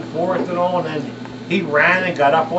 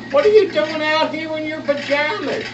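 higher-pitched scolding mom; mother scolding; 9 semitones higher than usual. Retold or imitated. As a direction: voice the mom catching her kid outside at night. Mother finds son outside.

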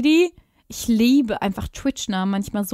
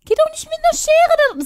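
outraged protest; 4 dB louder than usual. Real, not acted. Upset at on-screen haircut.

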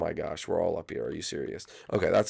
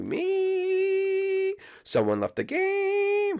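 high drawn-out; much slower than usual. Acted. Slow high announcer.

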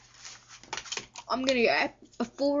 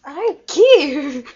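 ventriloquist dummy voice; 4 semitones higher, 11 dB louder, much slower than usual. Pretend. Still playing the dummy.

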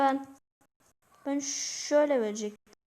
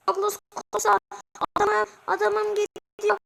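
higher and louder, laughing through it; going wild over a goal; 7 semitones higher, 6 dB louder, slower than usual. Real, not acted. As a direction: lose it with joy as your striker scores. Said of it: "Neden böyle çıldırdım bilmiyorum". (gol sevinciyle çıldırarak, gülerek)

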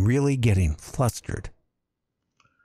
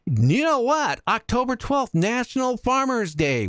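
excited announcement; 10 semitones higher than usual. Real, not acted. Announcing the day's holiday.

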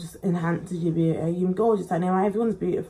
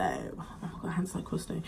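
whispered aside, softer; 3 semitones lower, 12 dB quieter than usual. Real, not acted. Doing her makeup on camera, whispering to herself. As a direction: breathe it to yourself.

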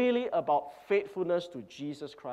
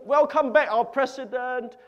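crowd cheering; 7 semitones higher, 6 dB louder than usual. Retold or imitated.

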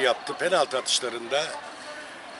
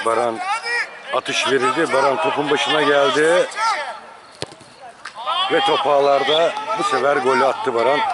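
live sports call, measured build; play-by-play commentary; 4 dB louder than usual. Acted. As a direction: call each penalty as it happens. Live penalty shootout commentary.

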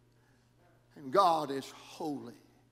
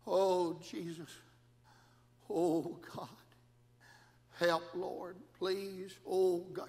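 retold desperate prayer; slower than usual. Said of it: "All I could say was".

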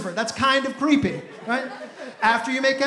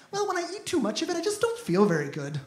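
softer, hurried timid voice; meek date; 5 semitones higher, 7 dB quieter than usual. Retold or imitated. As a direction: murmur it like a lame justification. Date's lame excuse voiced.

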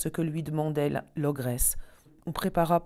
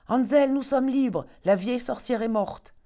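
joyful news; 8 semitones higher, 5 dB louder than usual. Acted. Gretel telling Hansel they are free, higher and excited. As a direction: announce freedom with delight.

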